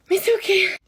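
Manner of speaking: high loud nervous reassurance; squeaky forced calm. Real, not acted. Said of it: "j'essaie de déstresser".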